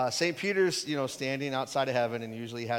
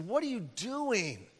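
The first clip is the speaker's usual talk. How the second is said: slow, higher, indignant rebuke; mild mock indignation, drawn out; 6 semitones higher, slower than usual. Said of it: "Jesus was indignant".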